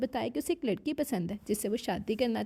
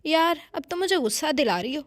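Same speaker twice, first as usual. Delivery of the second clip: exasperated, louder and higher; irritated; 5 semitones higher, 5 dB louder than usual. A narrator's reading. Husband pressed for his past loses patience with wife, irritated. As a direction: snap it out, fed up. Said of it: "वो झंझला कर बोला".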